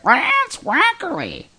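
very high, slow macaw squawk; drawn-out parrot mimicry; bird voice. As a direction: screech it out like a talking macaw. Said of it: "they'll do it in a bird voice".

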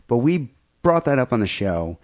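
ordinary talk

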